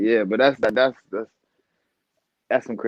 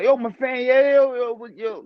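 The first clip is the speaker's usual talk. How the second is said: higher, hyped fan shouting yo; eager fan; 11 semitones higher, 4 dB louder than usual. Retold or imitated. Fan spotting the boxer, hyped and higher. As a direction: play the buzzing fan spotting him. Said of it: "you might look at".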